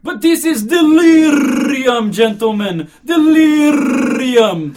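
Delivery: high, very loud, slow roar with rolled r's; frenzied exclaiming. Acted. Old commissioner exclaims to the gentlemen, roaring in outrage. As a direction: bellow it, drawing words out. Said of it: "the police commissioner kept exclaiming".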